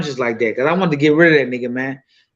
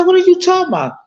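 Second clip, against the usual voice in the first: much higher, incredulous question; retold baffled reply; faster than usual. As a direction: sound lost and taken aback. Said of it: "I said".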